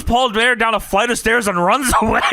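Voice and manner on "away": usual speaking voice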